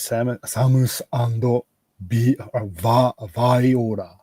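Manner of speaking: slow, "Viola" as vy-oh-da with tapped r; Japanese pronunciation. Shown as a demonstration. Reading the Japanese name for co-hosts, "Viola" as vai-o-ra. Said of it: "Jap-, in Japanese".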